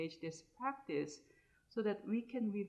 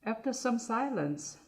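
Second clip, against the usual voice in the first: guided meditation; 2 semitones higher, 7 dB louder than usual. Acted. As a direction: guide listeners phrase by phrase, unhurried, more projected than chatting.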